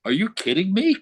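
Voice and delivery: outraged disbelief, pitch way up; high, indignant challenge; going off on her. Retold or imitated. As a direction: retell an incredulous outburst. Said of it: "I went the fuck off on her".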